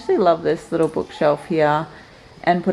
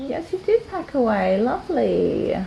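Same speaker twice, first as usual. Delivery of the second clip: praising; 7 semitones higher than usual. Real, not acted. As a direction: praise the little one gently. Praising a child.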